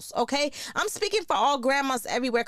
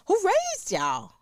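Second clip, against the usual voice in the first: slow, higher, incredulous question; drawn-out disbelief; 9 semitones higher, much slower than usual. Acted. Host scolding careless parents, slow and higher in disbelief. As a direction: stretch it out, scandalized.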